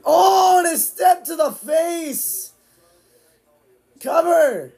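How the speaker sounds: high, slow, shouted hype; excited shout. Real, not acted. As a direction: yell it at the big hit. Live reaction to a kick.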